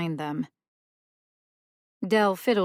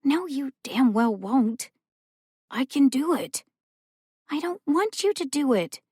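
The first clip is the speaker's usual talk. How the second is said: indignant refusal; 8 semitones higher than usual. Acted. Rowan refusing help, upset.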